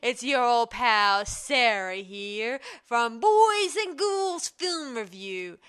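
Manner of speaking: loud, slow, high spooky horror host; jack o lantern voice. Acted. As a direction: drag out a ghoulish welcome to the kiddies.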